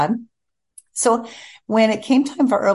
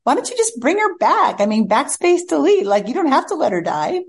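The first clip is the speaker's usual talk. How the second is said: high, lightly voiced husband offering a fix; a hint of the husband, higher, proposing a simple fix; husband urging the writer to undo the death, higher; husband's suggestion; 8 semitones higher than usual. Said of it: "then he said".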